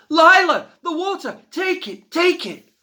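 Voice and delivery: very high, loud desperate call; urgent shout. A narrator's reading. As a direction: yell across the flames. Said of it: "a voice was shouting".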